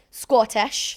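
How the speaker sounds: one word in a hammed-up Scottish accent; playful Scottish imitation; mock Scottish accent. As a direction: say it loud with a put-on Scottish twang. Mock accent after Scottish dad.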